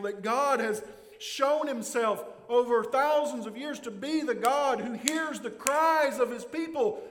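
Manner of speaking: ordinary delivery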